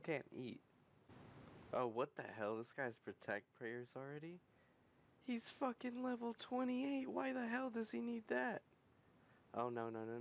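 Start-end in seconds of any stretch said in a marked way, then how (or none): 5.3-8.6 high-pitched, whining outrage; indignant. Real, not acted. Gamer griping at a level twenty-eight's prayer, indignant. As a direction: complain high and incredulous.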